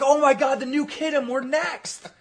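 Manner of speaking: high, loud feigned terror; mock panic. Team pretends the nuke hit their friend, hammed-up panic. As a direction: cry out as if the blast is coming for you.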